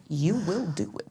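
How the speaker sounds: softly laid-down law; quiet command. Retold or imitated. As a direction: imitate a wife who needn't raise her voice. Wife's order against own reply.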